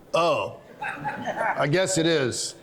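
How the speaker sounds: very slow put-on salesman accent; mock shop-guy accent, drawn out; slow, comic store-clerk accent as realization sinks in; Chet accent. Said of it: "have to talk in this accent when I do Chet".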